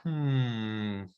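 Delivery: slow, wary gorilla voice; pondering creature, a touch of distrust; suspicious gorilla. Acted. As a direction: mull it over suspiciously, drawing out the words. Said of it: "start getting a bit suspicious".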